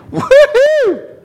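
very high falsetto whoop; gleeful whoop. Pretend. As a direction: whoop like you won. Mock reaction to God's offer.

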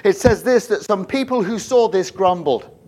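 high, loud preacher's projection; preaching. Real, not acted. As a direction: preach it out to the whole room. Preaches at full force.

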